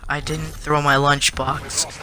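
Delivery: slow, deliberate retold protest. Kid answering after being told off by the principal, slow and toned down. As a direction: say it slowly, reining the voice in. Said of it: "Don't, don't raise your voice".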